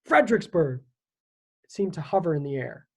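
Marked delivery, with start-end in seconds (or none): 0.0-0.8 shouted. A narrator's reading. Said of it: "Someone shouted out a word".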